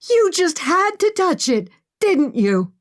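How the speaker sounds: high, pointed blame; accusing. Acted. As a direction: throw the blame at her. Emma blames Maggie for darkness.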